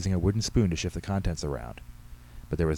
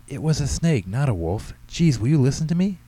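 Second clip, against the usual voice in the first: put-on high, loud indignant boy; exasperated protest; 7 semitones higher, 6 dB louder than usual. A narrator's reading. Boy arguing with his doubting mother, exasperated. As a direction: demand to be heard like an exasperated child.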